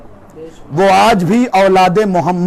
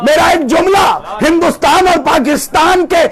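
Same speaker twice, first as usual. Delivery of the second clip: raised, shouted sermon voice; fiery preaching; 6 semitones higher than usual. Acted. Preacher rousing his congregation with a story, shouting.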